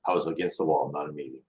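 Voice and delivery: ordinary talk, nothing marked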